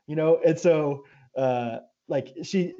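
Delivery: usual speaking voice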